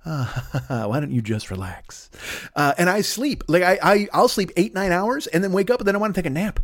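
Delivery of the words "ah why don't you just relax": low, soft, drawn-out sighing voice; body shutting down. Retold or imitated. Voicing the body telling its owner to relax, low sleepy murmur. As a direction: sink into a slow, low, lazy exhale with a soft chuckle. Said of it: "shuts itself down and just like".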